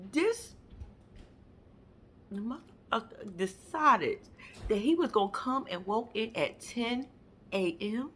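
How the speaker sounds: normal talking voice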